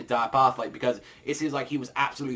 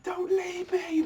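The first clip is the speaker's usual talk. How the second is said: acting out a high, desperate scream; anguished scream; slower than usual. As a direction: cry out for your mother as she dies. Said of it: "just screaming out".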